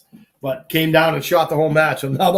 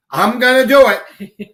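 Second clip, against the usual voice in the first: imitating his mother, high and loud, insisting; stubborn mother; 7 semitones higher, 6 dB louder than usual. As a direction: play the mother who won't be talked out of it.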